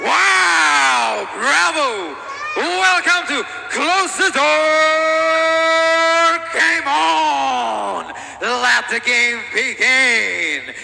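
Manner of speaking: drawn-out, booming ring announcer; hype announcer. Acted. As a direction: stretch every word to hype the crowd. Ring announcer hypes crowd.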